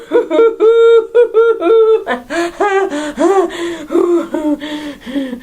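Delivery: a long helpless giggle; giggling fit at her own crush; long giggling laugh. Acted. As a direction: laugh it off, overcome by the fantasy. After swooning over Roger.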